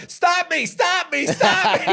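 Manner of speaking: put-on very high, loud kid's cry; mock frantic plea. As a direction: shout for help as if speeding past, played for laughs. Imitates son calling out while speeding by.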